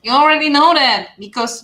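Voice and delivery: ordinary delivery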